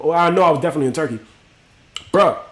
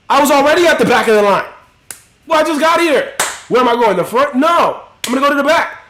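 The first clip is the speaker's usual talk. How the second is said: higher and louder, building the rant; excited outburst; 7 semitones higher, 4 dB louder than usual. Real, not acted. Telling the chat he has nothing to lose, excited higher louder outburst. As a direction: shout it like it's obvious and absurd. Heated argument in a worst-case rant.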